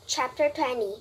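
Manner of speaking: ordinary delivery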